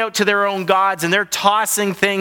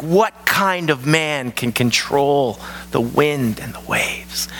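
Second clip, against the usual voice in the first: awed whisper; 4 semitones lower, slower than usual. Acted. Disciples freaked out.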